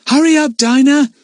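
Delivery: loud, high urgent call. A narrator's reading. Lucy Ann calling to Dinah, loud and uneasy. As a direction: call back through the tunnel. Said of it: "called Lucy Ann".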